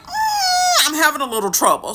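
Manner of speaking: high, whimpering mock distress, fast; squeaky put-on whine, rushed; whiny helpless squeal. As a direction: play the helpless girl at the stove. Struggling with the stove.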